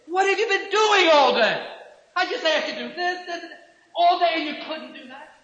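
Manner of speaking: high, loud exasperated scolding; husband blowing up at his wife; irritated husband. Retold or imitated. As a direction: rant at the wife who did nothing all day. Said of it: "Doesn't that sound like irritation?".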